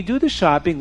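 plain reading voice

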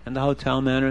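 ordinary delivery, nothing marked

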